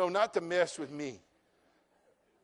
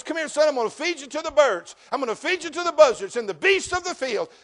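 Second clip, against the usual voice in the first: higher, louder giant's taunt; taunting Goliath; 7 semitones higher, 6 dB louder than usual. Retold or imitated. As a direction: bellow a threat at the boy. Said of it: "And Goliath says".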